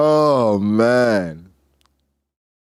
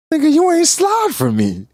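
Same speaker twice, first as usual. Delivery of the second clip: whiny Thatch; 3 dB louder than usual. Retold or imitated. Repeating Thatch's complaint.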